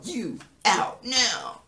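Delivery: high, drawn-out stern command; barked order. Acted. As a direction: order someone out, word by word. Justin ordering Jeffrey out of cell.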